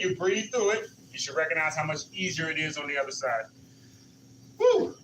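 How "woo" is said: excited cheer. Real, not acted. Coach cheering mid-exercise.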